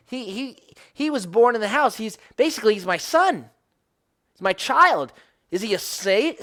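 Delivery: rising anguished protest; impassioned plea. Retold or imitated. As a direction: cry it out like a wounded father. Passionate paraphrase of God's lament.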